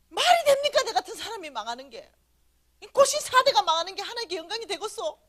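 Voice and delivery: loud, high-pitched reenacted tirade; ranting in outrage. Speaker re-enacting her rant at God, loudly outraged. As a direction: pile up grievances, demanding justice. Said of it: "이러면서 막 난리를 친 거죠".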